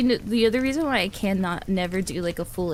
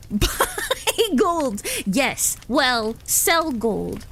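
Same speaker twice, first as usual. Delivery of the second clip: a burst of laughing, higher eagerness, then slowing way down on "sell gold"; real giddy excitement that runs away with her; excited; 6 semitones higher, much slower than usual. Real, not acted. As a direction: get carried away over gold, then drag the correction out slowly. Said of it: "Sorry, I got excited there".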